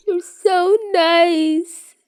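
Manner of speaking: high, slow, tearful wail; teary and high. Acted. Drugged Peter tearfully thanking Tony, high wail. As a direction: gush through tears, voice way up. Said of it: "eyes start welling up with tears".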